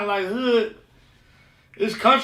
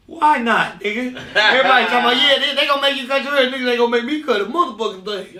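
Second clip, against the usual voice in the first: loud defiant retelling; 3 semitones higher, 3 dB louder than usual. Retold or imitated. Defiant retelling of haircut order.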